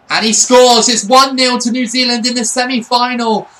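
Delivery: loud, rising goal celebration; goal call. Real, not acted. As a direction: shout the goal out. Own team scores.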